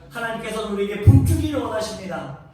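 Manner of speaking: loud, high, swelling pulpit declamation; preaching at full force. Acted. Pastor proclaiming God's love to the congregation, full-force preaching.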